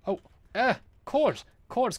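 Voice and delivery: sudden high call; urgent callout. Real, not acted. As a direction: shout it out in alarm.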